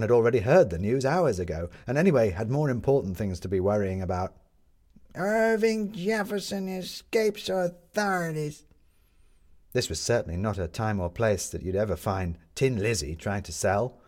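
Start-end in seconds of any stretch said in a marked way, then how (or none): 5.1-8.7 high, slow headline cry; newsboy calling headlines. Acted.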